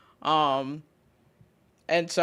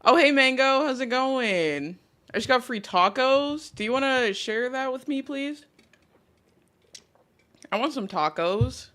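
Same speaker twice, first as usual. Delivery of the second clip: ordinary talk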